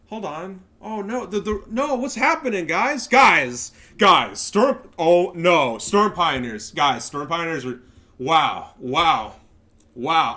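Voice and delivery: loud, high fake alarm shouting at the viewers; mock panic. Showing viewers the clip, loud hammed-up panic. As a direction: ham up the shock like a disaster.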